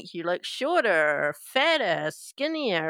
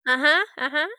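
1 Steve's voice; 6 semitones higher, 4 dB louder, faster than usual. Acted.

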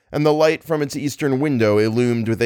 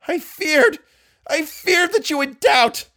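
shriek. Acted. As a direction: shriek it in despair. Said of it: "voice had risen to a shriek".

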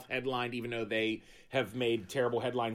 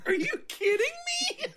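laughing disbelief. Real, not acted. Laughing disbelief at tooth fairy.

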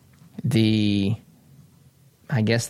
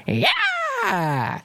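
a much higher, yelping parody of the scream; mock Dean scream. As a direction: screech it back like the clip. Imitating the famous Dean scream.

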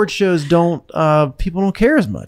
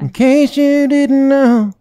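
sung-out nasal drawl, slow and higher; nasal singer line; 11 semitones higher, 4 dB louder, much slower than usual. Acted. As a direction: stretch it through the nose. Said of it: "great singers that are nasal".

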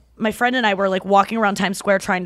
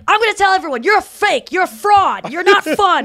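higher, louder taunting accusations; mock accusing Donnell; 9 semitones higher, 5 dB louder than usual. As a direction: jeer at her like a wounded friend. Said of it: "he was just like".

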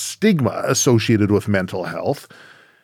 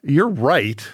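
higher-pitched, mildly exasperated retort; retold exasperated wife; 5 semitones higher than usual. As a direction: quote your wife pushing back in an argument. Retold argument with his wife.

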